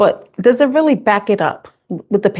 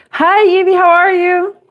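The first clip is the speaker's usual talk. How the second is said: bright, much higher hello; cheerful greeting; 9 semitones higher than usual. Real, not acted. Live reader greeting Evie in chat, a high cheerful hello. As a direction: sing out a welcome to the viewer.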